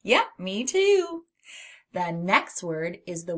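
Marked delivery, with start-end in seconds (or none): 0.0-1.2 high, bouncy agreement; slow, beaming "yep, me too"; cheery yes. Acted. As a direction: answer like a delighted teacher. Cheerful answer to children.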